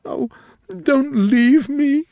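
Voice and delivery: very high, loud, slow wail; passionate cry. Acted. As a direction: stretch out leave in anguish. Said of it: "she cried passionately".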